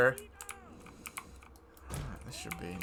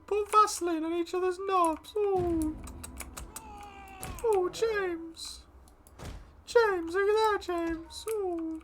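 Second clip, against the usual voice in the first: much higher, slow, sing-song mocking coo; silly high voice; slower than usual. Gamer teasing friend about playing with James, high mocking coo. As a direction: coo it like a tease winding up a mate. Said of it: "I'm in a voice go".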